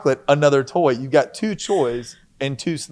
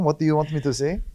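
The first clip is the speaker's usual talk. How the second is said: higher-pitched French accent; 4 semitones higher, faster than usual. Shown as a demonstration. Co-host asks to hear the play accent, playful French accent. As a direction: ask it with a put-on French accent. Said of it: "In my little French accent".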